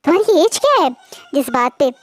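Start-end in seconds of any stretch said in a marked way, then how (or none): 0.0-0.9 higher, louder startled question; shocked. Acted. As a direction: blurt it out in disbelief. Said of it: "shockingly बोलता है". (हैरानी से, ऊँची और तेज़ आवाज़ में)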